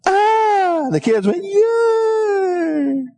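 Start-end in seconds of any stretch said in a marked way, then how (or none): 0.1-0.9 high startled ah; mock shriek of alarm; wife's shriek. Wife's reaction retold. 1.4-3.1 high long yay; held high childlike yay; kids' cheer. Retold or imitated. Kids cheering retold.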